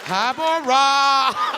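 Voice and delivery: loud, slow, drawn-out greeting; swooning fan. Acted. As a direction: stretch the name like a smitten fan. Smitten fan greeting, repeated.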